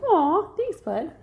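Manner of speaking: put-on high, tender cooing at the baby. Mom coos thanks to her baby son after his kiss. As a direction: melt over a sweet little kiss.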